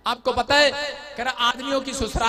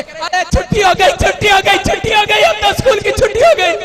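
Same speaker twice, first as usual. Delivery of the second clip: much higher, loud gleeful chant; cheering kids; 11 semitones higher, 11 dB louder than usual. Retold or imitated. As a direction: shout for joy like kids let off school. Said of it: "बच्चे कह रहे".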